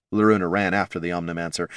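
ordinary delivery, nothing marked

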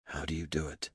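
barely audible, very soft; whisper. A narrator's reading. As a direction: breathe the question almost silently. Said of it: "softer than a whisper".

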